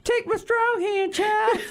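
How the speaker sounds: mock-grand offer of a hand, hammed up; Christopher Walken impression. Christopher Walken offering his hand to the child, slow hammy impression. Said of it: "Imagine Christopher Walken".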